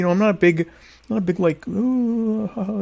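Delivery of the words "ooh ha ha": mock chatty laugh. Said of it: "you know, talk".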